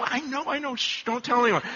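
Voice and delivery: mock furtive, hushed confession; secretive hush. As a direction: shush them as if guarding a secret. Hushing colleague about a secret.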